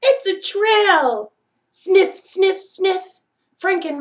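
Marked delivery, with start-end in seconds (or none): 0.0-1.3 slow, louder, higher cry of discovery; thrilled it's a trail; excited discovery. Acted. 1.7-3.0 quick sniffing noises; playful sniff sniff. A narrator's reading. Dog sniffing trail again.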